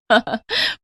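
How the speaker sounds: short, teasing giggle; amused laugh. Acted. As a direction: let a little laugh slip out. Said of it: "मुझे हंसी आ गई".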